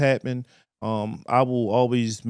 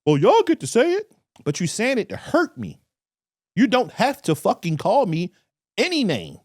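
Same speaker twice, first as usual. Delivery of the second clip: high, louder, complaining mock voice; 10 semitones higher, 4 dB louder than usual. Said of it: "they'd be like".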